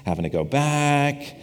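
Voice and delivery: lightly voiced, a hint of a stingy grumbler; grumbling Judas. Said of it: "Judas is probably like".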